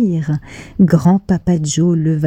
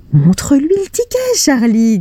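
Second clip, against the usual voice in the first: eager grandpa; 7 semitones higher than usual. Acted. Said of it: "dit grand-papa Joe".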